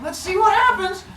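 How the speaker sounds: very high, cheeky and silly; hammed-up high-pitched tease; mock squeaky voice. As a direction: squeak it like a giddy troublemaker. Mock toddler with blowtorch.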